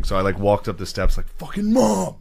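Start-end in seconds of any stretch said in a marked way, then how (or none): 1.4-2.1 yell, annoyed at mom; angry yell. Retold or imitated. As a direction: shout up the stairs at mom. Angry teen yelling at mom.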